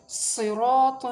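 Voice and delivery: normal talking voice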